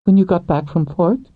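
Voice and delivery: lightly voiced young woman; woman's voice. A narrator's reading.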